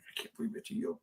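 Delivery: breathy whispered imitation of admirers; whispered quote. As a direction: whisper it like an awed fan. Quotes admiring restaurant staff.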